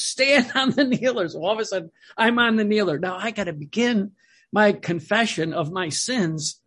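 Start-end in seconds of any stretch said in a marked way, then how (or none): 0.0-1.3 high-pitched imitated command; deaf priest. Deaf priest orders the boy in the confessional, high-voiced. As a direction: give the order high, as if hard of hearing. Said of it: "the other thing too is he was deaf".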